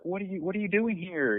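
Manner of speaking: imitating a friend's delighted surprise at the door; surprised. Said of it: "pleasantly surprised".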